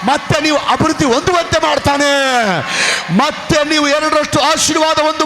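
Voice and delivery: loud, high, rising sermon shout; full-force preaching. Acted. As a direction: preach it to the rafters.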